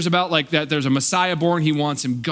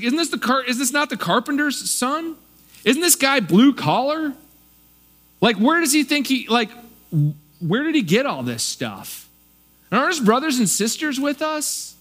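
rising to a high, scornful isn't this the carpenter's son; indignant questions piling up, pitch climbing; offended hometown crowd; 7 semitones higher than usual. Retold or imitated. Offended locals belittling the carpenter's son, high scorn. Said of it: "he's like".

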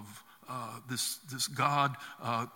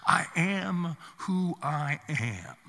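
very slow, solemn and measured; God's answer; much slower than usual. Acted. God answering Moses from the bush, slow and solemn. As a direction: lay each word down like a pronouncement.

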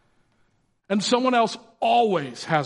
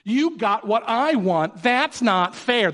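put-on slow, higher childish whine; whiny kid; 4 semitones higher, slower than usual. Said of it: "That's how they say it too".

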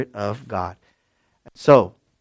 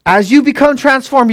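normal talking voice

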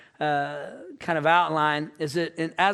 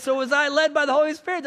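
high questioning prompt; hopeful quiz question; 7 semitones higher, 4 dB louder than usual. Retold or imitated. Dad asking his kids about kindness, hopefully high. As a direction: pitch it up, asking for a grade.

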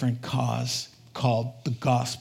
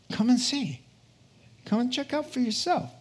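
Philip's invitation; 9 semitones higher than usual. Acted. Philip inviting Nathaniel, voiced high.

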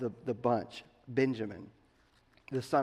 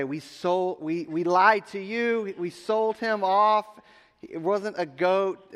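slow, drawn-out, higher-pitched admission; acted awkward confession; 5 semitones higher, 4 dB louder, slower than usual. Brothers admitting the lie to Dad, acted sheepish confession. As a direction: reluctantly stretch out the mock confession.

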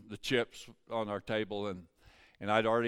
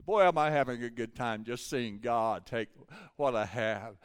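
usual speaking voice

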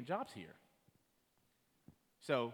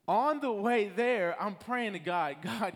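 rising fervor; 6 semitones higher, 7 dB louder, slower than usual. Real, not acted. Building preaching climax.

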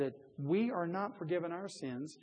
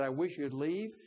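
ordinary talk

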